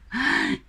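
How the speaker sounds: short high-pitched laugh; acted laugh. Answering the demon's curse, acted high laugh. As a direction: let out a brief, high laugh.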